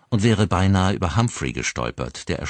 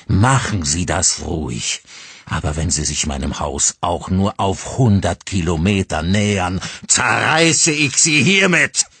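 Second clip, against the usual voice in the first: trembling rage; 2 semitones higher, 6 dB louder than usual. Acted. Father threatening the driver, trembling with rage. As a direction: threaten her, shaking with fury. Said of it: "bebend vor Zorn".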